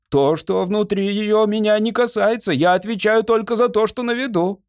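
disclaim all blame in a high, insistent voice; defensive servant. A narrator's reading. Servant answers the lord about strange noises, defensive.

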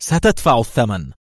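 contained angry threat; angry cry. A narrator's reading. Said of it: "فصاحت غاضبة".

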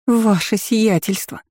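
a hint of bursting into tears; wailing cry. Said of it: "заревела девушка".

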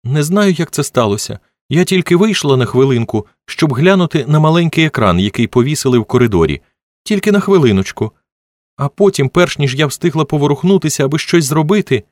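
high-pitched, distressed excuse; upset and high, explaining what went wrong; tearful excuse. A narrator's reading. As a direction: plead your case, close to tears. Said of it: "Менді Терріс була в сльозах".

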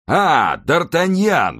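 loud, high, drawn-out greeting; joyful exclamation. A narrator's reading. Bored Porthos spots his friend from the window, exclaiming. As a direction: call out to a friend you have been waiting for. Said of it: "воскликнул он".

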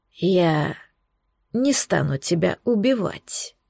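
slow, soft sinister hiss. A narrator's reading. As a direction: hiss the words low and cold. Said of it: "сказал он шипящим голосом".

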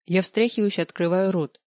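usual speaking voice

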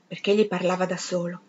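plain reading voice

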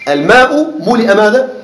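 ordinary talk, nothing marked

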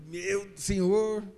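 very slow, stretched exclamation; drawn-out sigh of dismay. Retold or imitated. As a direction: let it out slowly, half-exasperated. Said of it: "Eu falei".

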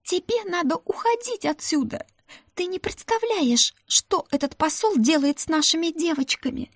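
high, frantic panicked pleading; hysterical. Acted. Ruta warning her friend about the ambassador, hysterical. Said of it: "заистерила она".

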